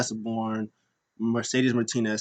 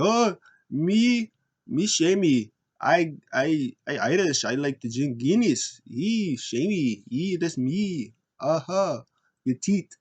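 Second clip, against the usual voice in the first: hammed-up mock Irish accent, pitched up; mocked Sheamus accent; 5 semitones higher, slower than usual. Wrestlers making fun of Sheamus, mock Irish accent. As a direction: lampoon an Irish accent in simple phrases. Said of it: "made fun of, um, Sheamus and, uh, Drew's accents".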